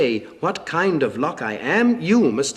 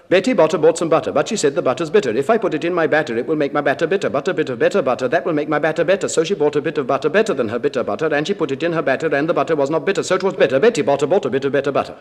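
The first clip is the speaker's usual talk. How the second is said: rattled off quickly, in rhythm; fast tongue twister; much faster than usual. Shown as a demonstration. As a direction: race through the twister keeping time. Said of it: "Do you think you can keep time with this?".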